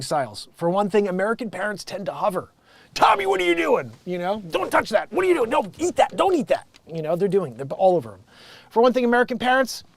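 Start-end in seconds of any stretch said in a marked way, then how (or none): 2.9-3.9 high-pitched mock parental alarm; hovering parent. American parent hovering on the playground, mock alarmed. 4.5-6.7 high, frantic mock warnings; hovering parent. Parent hovering over kid at playground, mock frantic.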